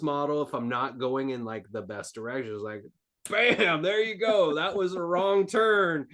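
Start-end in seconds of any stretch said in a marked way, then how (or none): 3.3-6.1 high, punchy exclamation, hammed up; mock loud correction. Universe punching down after a wrong turn, mock scolding. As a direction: burst in loudly like a sudden course correction.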